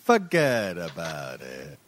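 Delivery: put-on low, drawn-out Italian-American accent; slow mock Italian-American wise guy. Host imitating Friends' Italian-American, low slow accent. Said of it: "all of my Italian American".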